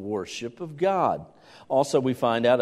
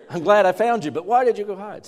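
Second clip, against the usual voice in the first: higher, louder mix of relief and scolding; half hug, half scold the found child; relieved scolding parent; 8 semitones higher, 6 dB louder than usual. Retold or imitated.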